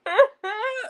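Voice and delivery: loud, high-pitched fake wail; hammed-up bawling in short bursts; mock ugly crying. Vlogger imitating her full-blown breakdown, mock sobbing. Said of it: "ugly tears, like, full-blown".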